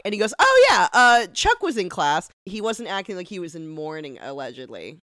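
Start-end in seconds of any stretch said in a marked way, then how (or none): 0.4-2.3 very high, loud exclamation; mock sudden recall. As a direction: act out suddenly remembering, over the top. Acting professor suddenly remembering.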